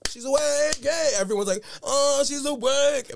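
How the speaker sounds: hammed-up excited squeal; mock cheering. Imitating everyone celebrating that the comatose girl woke up, high and excited.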